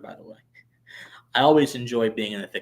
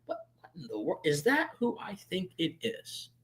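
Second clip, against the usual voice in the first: stunned disbelief; 5 semitones higher, 8 dB quieter than usual. Retold or imitated. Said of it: "I'm like".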